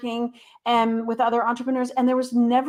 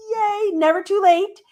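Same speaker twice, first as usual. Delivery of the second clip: high, bright little cheer; excited cheer; 10 semitones higher, 5 dB louder than usual. Real, not acted. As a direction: cheer for yourself. Celebrating reaching her goal.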